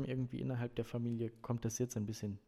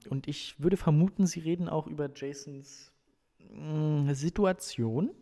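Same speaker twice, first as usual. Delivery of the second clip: higher, halting, rising at the end; tactful hesitation; 4 semitones higher, 10 dB louder than usual. A narrator's reading. Mother hints to the coach about her child, tactfully hesitant. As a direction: search carefully for a delicate word. Mother broaches delicate subject.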